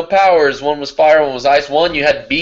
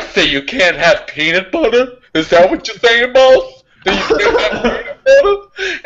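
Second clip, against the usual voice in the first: mock incredulous questioner; 8 semitones higher than usual. Imitating people quizzing him about his allergy, mock incredulous. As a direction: pitch climbing higher as the questions pile up.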